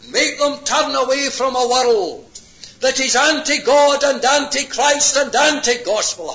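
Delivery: loud, high denunciation; thundering pulpit warning; fiery preaching. Real, not acted. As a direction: hammer the words like a revival preacher. Preacher denounces the world.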